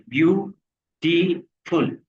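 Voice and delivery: Beautiful slowly split into three separate syllables, ब्यू-टी-फुल. Shown as a demonstration. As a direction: break beautiful into slow, distinct syllables for counting. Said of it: "कितने syllable है". (Beautiful को धीरे-धीरे तीन हिस्सों में तोड़कर, ब्यू-टी-फुल)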